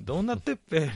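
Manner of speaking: どうなってっぺ with a rural Ibaraki っぺ ending; 茨城弁で「どうなってっぺ」; Ibaraki dialect version. Retold or imitated. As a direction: switch the question into Ibaraki dialect. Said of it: "みたいな".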